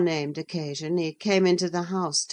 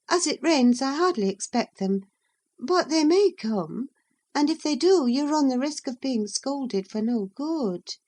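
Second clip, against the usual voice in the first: put-on high, quick girl's voice, talking him out of staying; Catherine's voice; 9 semitones higher than usual. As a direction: pitch it high and hurry the warning. Catherine warns Heathcliff.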